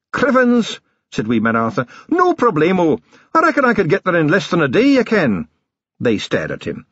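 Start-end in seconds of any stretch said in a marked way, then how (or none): 0.1-0.8 high-pitched exclamation from a tiny watchman; tiny feisty watchman. Acted. Wee Mad Arthur answering the captain, high and feisty. 2.1-5.5 high, confident little watchman's voice; tiny feisty watchman. Acted. Wee Mad Arthur volunteering for the journey, high and confident.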